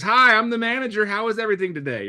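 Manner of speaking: a hint of a fake-cheery manager greeting; awkward manager.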